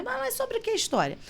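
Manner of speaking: high, eager questioning lilt; curious question. Retold or imitated. Teacher urging a student to tell the story, high and eager. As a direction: ask it brightly to draw them out. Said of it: "Sempre estimulo e pergunto".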